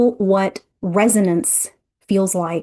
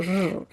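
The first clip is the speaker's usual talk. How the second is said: very soft, sinking "Ugh"; deflated groan; 9 dB quieter than usual. Retold or imitated. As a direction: let the energy drop out in a soft groan. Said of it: "I felt my energy go".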